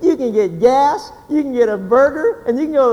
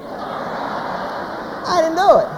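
higher, drawn-out guilty denial; little boy; 5 semitones higher, much slower than usual. Retold or imitated. Six-year-old boy to his mother after the earthquake, defensive. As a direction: plead innocence like a small child. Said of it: "the first thing he says is".

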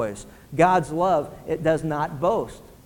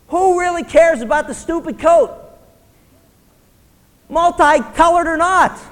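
much higher, louder preacher climax; preaching at full force; 9 semitones higher, 6 dB louder than usual. Acted. Pastor rails at the congregation about petty envy, fervently. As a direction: drive it up to a fervent peak. Sermon climbs to a peak.

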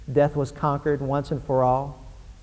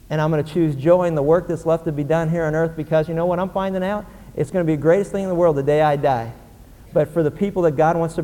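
firm, raised preaching voice; raised preacher voice proclaiming joy; preaching with conviction; 2 semitones higher, 4 dB louder than usual. Real, not acted.

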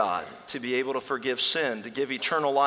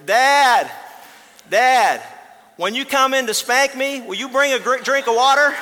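put-on much higher, loud childlike voice; a cheeky small boy asking for water; little boy calling; 11 semitones higher, 9 dB louder than usual. Boy asks for water once more.

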